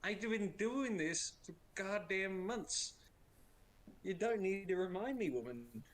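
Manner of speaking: hammed-up fed-up husband; exasperated retort. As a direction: snap back at the nagging wife in mock exasperation. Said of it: "It's like".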